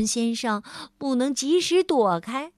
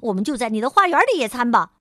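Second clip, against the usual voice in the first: louder, very fast; fast busy talk; 6 dB louder, much faster than usual. Acted. Mr. Busy deciding picnic in the garden, very fast. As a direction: rattle off the plan.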